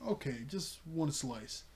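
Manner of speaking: slow, drawn-out giving in; acted-out hesitant yes; reluctant agreement. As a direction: concede slowly and a little softly. Voicing Terra reluctantly giving in.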